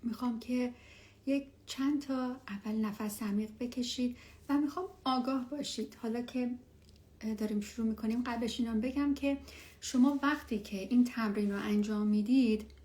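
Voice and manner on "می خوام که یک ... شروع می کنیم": guided meditation. Acted. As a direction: ease them gently into stillness. Leads a meditation.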